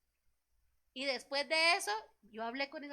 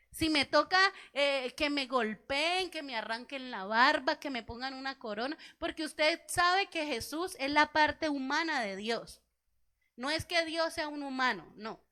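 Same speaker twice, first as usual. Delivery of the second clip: loud, slowed preaching climax; 6 dB louder than usual. Acted. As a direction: hammer each phrase of the suffering. Preacher on Christ's suffering.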